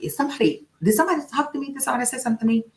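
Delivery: high, puzzled asking around; girl hearing a voice in the car, startled. Retold or imitated. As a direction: look around spooked, voice up high. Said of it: "looking around in the car like".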